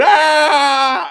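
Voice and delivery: loud high panicked scream; terrified scream. Retold or imitated. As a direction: scream like the man before the steamroller. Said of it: "They're that guy going".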